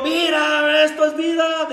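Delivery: high, drawn-out reckless cry; imitating a carefree yell; reenacted shout. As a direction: call it out like revellers from a passing car. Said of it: "Dice que pasaron gritando a ellos".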